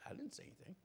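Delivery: breathy whisper, no voice at all; whispered denial. Retold or imitated. Wife through the cabin door, whispering back. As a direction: hush it, barely there.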